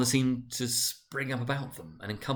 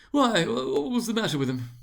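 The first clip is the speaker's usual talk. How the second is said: a much higher stammering merchant; third merchant, high; 11 semitones higher, 5 dB louder than usual. A narrator's reading. Third merchant asking about the death, high and stammering. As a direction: pitch it up high and stumble in. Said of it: "asked a third".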